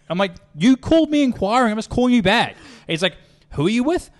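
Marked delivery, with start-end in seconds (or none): 0.6-2.6 much higher and louder, incredulous; pleading disbelief that they forgot; exasperated insistence. Retold or imitated. To a caller who claims not to know them, incredulous. Retold call, caller denies. 3.5-4.1 higher, mock wary question; suspicious caller. Customer on the phone, mock suspicious and higher.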